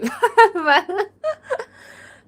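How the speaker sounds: high, loud helpless laugh; real laughter breaking out; burst of laughter. Real, not acted. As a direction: crack up at your own attempt. Reacting to own foreign attempt.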